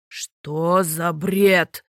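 very slow, low indignant outburst; the king flares up. A narrator's reading. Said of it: "взвился морской царь". (низко и медленно, взвившись от возмущения)

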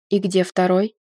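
low-key stern question, rising at the end; strict male supervisor, lightly voiced. A narrator's reading. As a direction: ask sternly, lifting the final word. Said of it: "строго окинув меня взглядом".

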